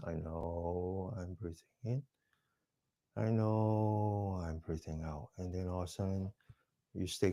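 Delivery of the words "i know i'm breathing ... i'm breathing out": very soft, slow self-talk; calm yourself under stress, barely voiced; whispering to yourself. Shown as a demonstration. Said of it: "you need to whisper to yourself".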